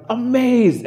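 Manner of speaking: high, drawn-out awe; acted wide-eyed marvelling; amazed. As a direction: stretch it out in mock wonder. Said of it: "you look up and you're".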